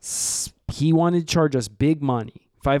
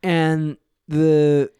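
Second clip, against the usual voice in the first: ordinary talk, nothing marked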